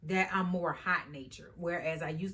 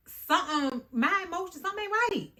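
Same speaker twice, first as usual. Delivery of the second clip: rising higher and higher, louder; something ain't right, pitched way up; emotional outburst; 11 semitones higher, 5 dB louder than usual. Real, not acted. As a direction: let it climb in disbelief. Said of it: "her emotions running high".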